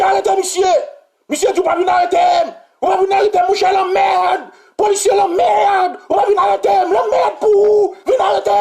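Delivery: retold screaming match; mock shouting quarrel. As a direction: shout it out like the standoff. Policeman and minister shouting exchange.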